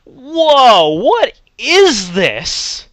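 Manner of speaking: loud, high stunned whoa; shocked exclamation. Retold or imitated. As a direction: shout it in disbelief. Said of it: "I'm just like".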